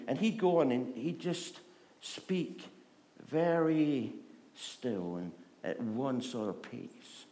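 usual speaking voice

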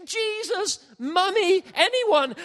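high, loud panicked cries for help; shouting in terror on a galloping horse. Retold or imitated. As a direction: yell for anyone to save you. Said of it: "I'm screaming, I'm shouting".

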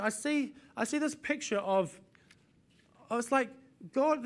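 ordinary delivery

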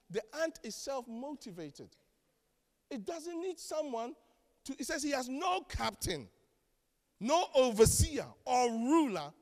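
usual speaking voice

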